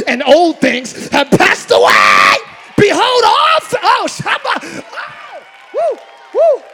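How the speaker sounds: impassioned preaching; full-force preaching. Acted. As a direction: build the sermon to a shout.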